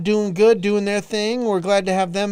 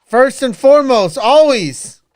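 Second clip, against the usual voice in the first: loud, high shout-out yell; 6 semitones higher, 11 dB louder, slower than usual. Acted. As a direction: yell it like a hype man. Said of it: "You fucking asshole".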